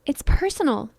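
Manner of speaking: lightly acted indignant protest; angry outburst. A narrator's reading. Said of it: "Cody slams his fist on the table".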